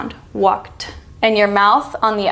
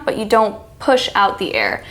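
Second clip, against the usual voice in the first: ordinary talk, nothing marked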